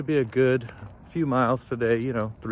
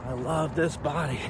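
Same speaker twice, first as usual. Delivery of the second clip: quiet mantra; 8 dB quieter than usual. Real, not acted. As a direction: say the mantra gently to yourself. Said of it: "Just keep saying that mantra".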